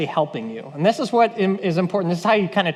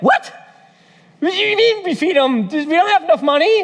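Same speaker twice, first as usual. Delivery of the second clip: high-pitched, flustered protest; hammed-up panicked objection; mock incredulity; 8 semitones higher, 3 dB louder than usual. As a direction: protest in comic disbelief. Acting disciples' panicked disbelief.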